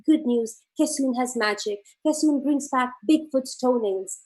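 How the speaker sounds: normal talking voice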